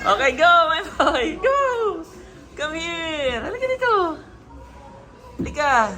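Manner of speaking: calling out. Real, not acted. Parent urging son to come over, calling out.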